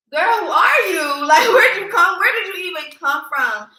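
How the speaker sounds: high, loud, laughing amazement; amazed disbelief. Retold or imitated. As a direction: squeal it out in happy disbelief, laughing through it. Grateful stranger helped her breathe.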